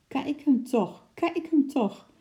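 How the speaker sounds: amused, high-pitched cry; laughing exclamation. A narrator's reading. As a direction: call it out high and drawn out, in amusement. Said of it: "riep de zeeman lachend uit". (geamuseerde, hoge uitroep)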